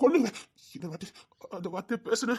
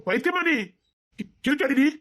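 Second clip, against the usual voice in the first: higher, louder, scolding her hard; furious shout; 4 semitones higher, 6 dB louder than usual. Acted. Man scolds the woman who talks of dying, furious shout. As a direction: yell at her like she's gone mad.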